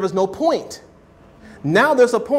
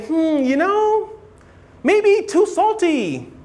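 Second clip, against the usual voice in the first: higher, slow, hesitant hedging voice; mock vague critic, slow and much higher; indirect hedging; 9 semitones higher, slower than usual. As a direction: hum and hedge, pitched well up and drawn out. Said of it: "By doing that indirect stuff".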